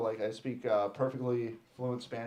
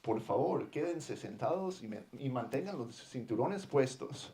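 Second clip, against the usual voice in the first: airline announcement; 7 dB quieter than usual. Acted. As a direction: recite a routine seatbelt notice into the intercom. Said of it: "They do the announcements".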